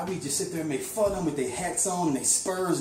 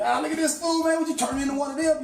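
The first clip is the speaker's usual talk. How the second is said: much higher, louder taunting jeer at a friend; mocking jeer; 13 semitones higher, 4 dB louder than usual. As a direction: jeer at him like he's a sellout in a cowboy outfit. The brothers laughing at him.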